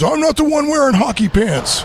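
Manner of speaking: high, hammed-up quote of a favorite movie line. Host quoting his favorite Dark Knight line, hammed up. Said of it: "my favorite line from The Dark Knight".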